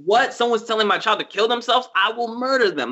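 mock outraged parent. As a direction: play the panicked parent who wants to murder someone.

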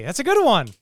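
high, loud insistence; indignant defense. Real, not acted. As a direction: defend your pick at full voice. Defending own film pick.

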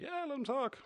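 high mock voice. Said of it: "So I'd be like".

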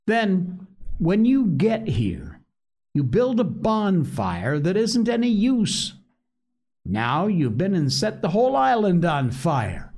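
put-on Piggy scolding the boys. A narrator's reading.

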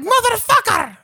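very high, loud mock scream; yelling. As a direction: imitate a furious mistress screaming after him. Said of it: "yells at him".

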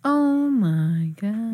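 stunned, slowly dragged-out exclamation; amazed. Real, not acted. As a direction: stretch it out, mind blown. Mind blown by casting reveal.

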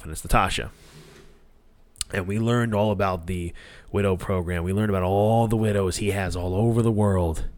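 normal talking voice

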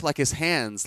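ordinary delivery

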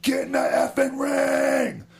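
high, slow furious shout; angry yell. Retold or imitated. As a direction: bellow it at your partner. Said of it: "And I was yelling".

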